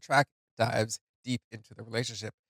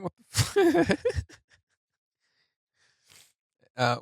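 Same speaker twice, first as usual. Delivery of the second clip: laughing through tears; 7 dB louder than usual. Real, not acted. Laughs at himself crying.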